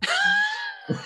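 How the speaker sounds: sudden high shouted laugh. Real, not acted. Singer laughing about losing her voice on stage. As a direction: burst out laughing.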